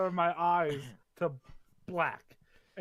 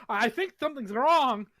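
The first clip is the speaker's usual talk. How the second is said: higher, slower fake worry; mock alarm; 6 semitones higher, 5 dB louder than usual. Prisoner fakes a seizure and says it worried, higher and slow. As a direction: feign a seizure, slowly.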